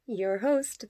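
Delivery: usual speaking voice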